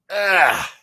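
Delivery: ordinary talk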